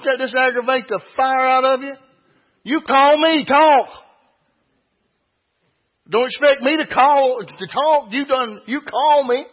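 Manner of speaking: preacher mocks a silent phone caller with exasperated scolding; exasperated rant.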